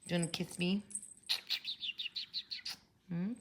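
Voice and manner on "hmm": ordinary delivery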